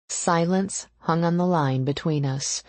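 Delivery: plain reading voice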